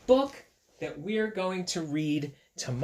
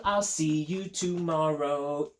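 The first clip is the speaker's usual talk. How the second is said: plain reading voice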